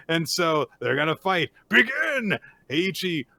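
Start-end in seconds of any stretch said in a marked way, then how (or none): 1.7-2.4 much higher, sharp call to begin; referee calls start. Retold or imitated. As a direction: announce the match start.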